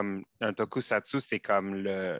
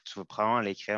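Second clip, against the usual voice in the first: normal talking voice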